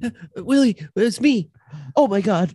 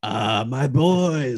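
low, slow dad voice; deep, lingering fatherly greeting; proud father; 10 semitones lower, much slower than usual. Acted. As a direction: greet them with drawn-out fatherly feeling. Father greets his sons.

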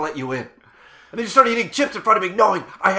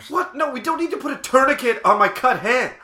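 alarmed protest; 6 semitones higher than usual. Acted. Alarmed at tourniquet suggestion.

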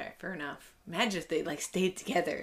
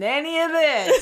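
laughing tease, louder; 9 semitones higher, 9 dB louder than usual. Real, not acted. Host teases the guest's brother while laughing. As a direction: laugh while warning him off.

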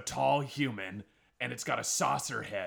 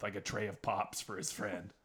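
normal talking voice

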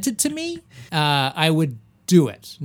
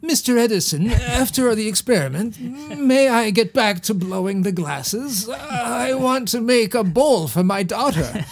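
meek, higher-pitched voice of a poor worker; pleading poor glassblower; 6 semitones higher than usual. Acted. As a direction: plead timidly with the boss, slow and high. Plays the poor glassblower pleading with Edison.

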